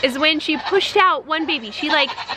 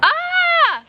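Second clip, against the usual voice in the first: very high shrieking goat yell; loud goat scream. Retold or imitated. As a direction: let out a piercing goat scream. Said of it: "she'll do it loud".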